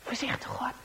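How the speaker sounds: hushed, sneaking whisper. A narrator's reading. Narrator whispering as the boys sneak closer. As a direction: breathe it like creeping up. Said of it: "sneaking up narration".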